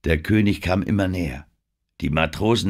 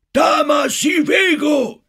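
schriller, gedehnter Schrei; 8 dB louder, slower than usual. A narrator's reading. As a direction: schrei sehr hoch und laut. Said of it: "schrie er". (kreischender alter Medizinmann)

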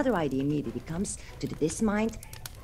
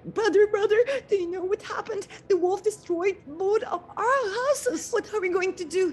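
much higher, loud frightened squeals; panicked piglets; 13 semitones higher, 4 dB louder than usual. Acted. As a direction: rush in begging for help.

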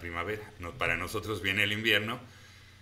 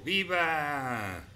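bright exclaimed cheer, higher; cheering viva; 5 semitones higher than usual. Real, not acted. Streamer answering the viewer's cheer, exclaimed. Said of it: "Yo digo viva".

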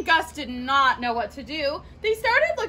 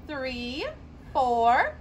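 ordinary delivery, nothing marked

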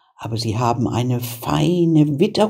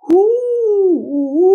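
long, high witch howl; drawn-out ghastly wail; 5 dB louder than usual. A narrator's reading. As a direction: howl loudly like a witch in agony. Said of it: "da fing die Hexe an zu heulen".

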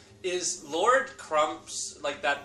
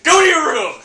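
higher, louder parent yelling; mock scolding shout; 10 semitones higher, 15 dB louder than usual. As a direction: bark it like an angry parent.

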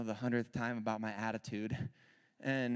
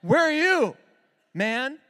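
much louder, higher, fast exasperated cry; mock angry shout at God; 10 semitones higher, 12 dB louder than usual. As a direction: yell at God like you're owed. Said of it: "I'm like".

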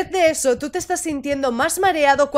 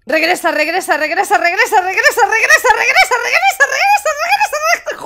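rising higher and louder into a laughing squeal; frantic urging; 8 semitones higher, 5 dB louder, faster than usual. Acted. Player voices the brain urging retreat, frantic rising squeal. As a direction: repeat it faster-feeling, climbing into giggly panic. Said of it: "Tu cerebro te dice de regresar".

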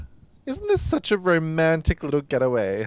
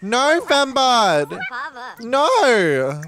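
loud, high, slow drawn-out no; exasperated yell; 9 semitones higher, 5 dB louder, much slower than usual. Real, not acted. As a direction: protest loudly at the sim. Yelling at her sim.

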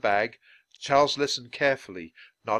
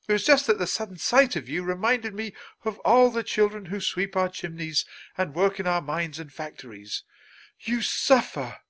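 high-pitched, heartfelt oration; impassioned Dickens; 9 semitones higher than usual. Acted. Dickens reminded of children who suffer at work, impassioned. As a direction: plead the children's cause with lifted voice.

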